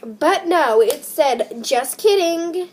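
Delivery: loud, high disbelief; stunned reaction to a twist ending; shocked outburst. Real, not acted. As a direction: blurt it out in shock. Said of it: "reacting to twist".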